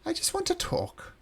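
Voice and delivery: very high, pleading-innocent voice; high lilting reassurance to wary guests; coaxing host. Acted. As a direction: coax them in with a high, harmless voice.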